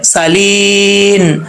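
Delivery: सालीन with a sharp whistling सीन and a thin, light vowel; tajweed recitation; thin whistled seen. Shown as a demonstration. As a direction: hiss the सीन in सालीन finely. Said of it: "बारीक है".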